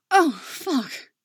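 high, loud gasping cry. Acted. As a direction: let it burst out on a gasp. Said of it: "she gasps".

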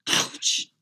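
loud breathy yelp; sudden pain. A narrator's reading. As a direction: yelp it as if hurt. Jerks back from a painful kiss.

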